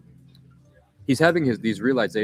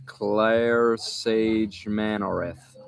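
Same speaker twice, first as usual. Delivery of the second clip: slow sounding out; much slower than usual. Real, not acted. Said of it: "crazy YouTube names".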